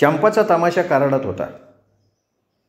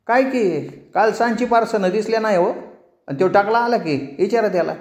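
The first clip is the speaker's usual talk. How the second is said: much higher, evasive servant voice; village servant; 9 semitones higher than usual. Acted. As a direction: shrug off the master's question. Servant answers master.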